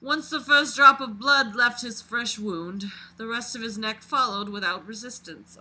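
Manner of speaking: dramatic read-aloud of a creepy story. A narrator's reading. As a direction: narrate it like a confession being read out. Reads the killer's story aloud.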